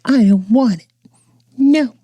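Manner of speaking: put-on whiny toddler refusal. Son refusing food with mom.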